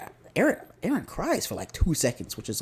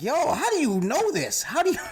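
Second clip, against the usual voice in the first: incredulous; 8 semitones higher than usual. Retold or imitated. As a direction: question it in exaggerated, mock-baffled amazement. Incredulous at character's knowledge.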